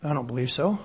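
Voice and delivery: low, hushed answer; quiet denial. Real, not acted. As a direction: drop low and soft to dismiss the idea. Quietly answers his own question.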